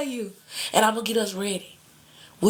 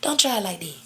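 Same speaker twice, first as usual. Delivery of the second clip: normal talking voice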